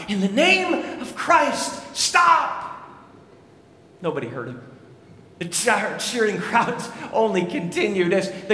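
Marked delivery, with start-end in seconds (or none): 0.1-2.7 slow, high call to stop the fight; shouted plea. Retold or imitated. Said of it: "he shouted out". 4.0-4.6 hushed. A narrator's reading. Dramatic hush after the cry.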